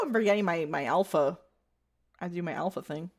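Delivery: ordinary delivery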